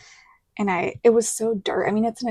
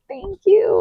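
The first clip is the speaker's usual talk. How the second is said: bright thanks; 11 semitones higher, 6 dB louder than usual. Retold or imitated. Replying to congratulations.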